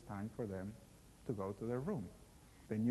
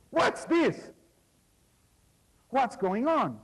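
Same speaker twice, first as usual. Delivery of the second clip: high, loud alarmed outburst; startled shout; 12 semitones higher, 11 dB louder, slower than usual. Acted. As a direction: leap back and yell it in shock. Knight jumps out of bed.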